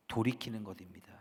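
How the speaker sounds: ordinary talk, nothing marked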